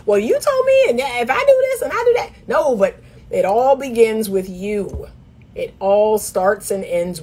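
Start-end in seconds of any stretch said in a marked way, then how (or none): 0.1-2.3 high, fast pleading put-on voice; whiny asker. Coach mocking women asking for tips, high and fast. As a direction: mimic a woman begging for a shortcut. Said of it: "They want me to give them a tip".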